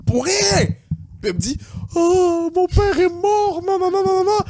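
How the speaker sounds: high, wailing imitation of her fake tears; mock crying girl. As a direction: whine it up high like a fake sob. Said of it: "elle commence à pleurer". (imitation aiguë de pleurs simulés)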